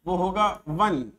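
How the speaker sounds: slow, clear वन with a w sound at the start; teacher models वन slowly for the class; pronunciation demo. Shown as a demonstration. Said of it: "अब इसका जो pronunciation होगा".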